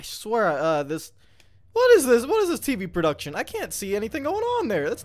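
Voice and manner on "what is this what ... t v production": high, loud exasperated question; baffled by the broadcast; incredulous. Real, not acted. Confused by camera view.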